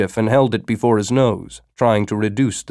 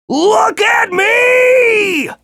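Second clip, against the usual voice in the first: very high, slow monster shout; a huge worm's drawn-out high cry; 4 dB louder, much slower than usual. A narrator's reading. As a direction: bellow it out as a giant worm. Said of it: "the worm shouted".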